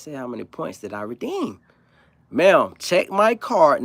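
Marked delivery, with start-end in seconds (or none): 2.3-3.8 each word stretched slowly and louder; slow and deliberate. Retold or imitated. To the cashier who ignores him, slow and louder. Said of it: "Now I'm talking like, it's like sign language".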